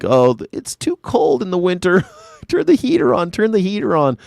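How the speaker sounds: high mock-girly pleading; whiny cold girl. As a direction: whine to get the heat on. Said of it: "And then girls are like".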